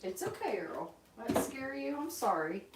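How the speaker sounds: calm the startled bird gently; soothing pet. Real, not acted.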